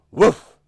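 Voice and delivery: sudden loud, high woof; fierce dog bark. A narrator's reading. Storyteller startling mice as the dog, fierce bark. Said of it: "barked a fierce, angry voice".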